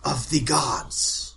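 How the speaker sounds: slow, halting, solemn pronouncement; village matriarch. Acted. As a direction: pause grandly between phrases. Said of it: "and then she says".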